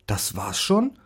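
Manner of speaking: can't believe it's already over; a touch of delighted surprise; surprised dog. Acted. Dog surprised at quick treatment.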